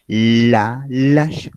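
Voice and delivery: "la, lash" with long, loud voiced L; vibrating initial L. Shown as a demonstration. Teacher shows the tongue vibrating, exaggerated L on "la, lash". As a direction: lean hard on the buzzing L in "la, lash". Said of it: "sí vibra la lengua".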